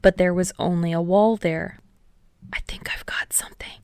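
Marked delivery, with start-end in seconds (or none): 2.5-3.8 hushed whispered report to the team. A narrator's reading. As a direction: whisper a tentative discovery. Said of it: "Sarah whispered as he inspected".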